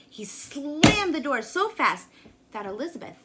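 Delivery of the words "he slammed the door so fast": dramatic slam. A narrator's reading.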